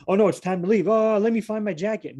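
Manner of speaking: hammed-up last-minute dismay; mock panic. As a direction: play at scrambling in a fluster. Mocking last-minute scramble to leave.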